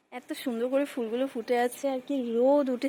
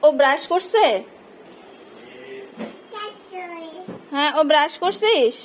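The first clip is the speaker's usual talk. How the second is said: loud voice across the house; calling out; 4 semitones higher, 11 dB louder than usual. Real, not acted. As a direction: shout over to the next room. Calling to husband about brushing.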